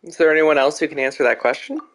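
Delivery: usual speaking voice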